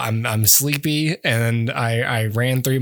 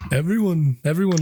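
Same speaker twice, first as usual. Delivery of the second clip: 1 character voice; 3 semitones higher, faster than usual. Acted. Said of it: "your impression is so, uh, walk hard".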